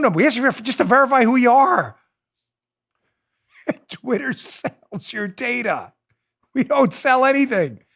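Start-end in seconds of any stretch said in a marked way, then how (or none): 3.7-5.9 slow, softer words through a laugh; can't keep a straight face. Real, not acted. Host mocking Twitter to listeners, chuckling through it. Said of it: "Sorry, I shouldn't laugh".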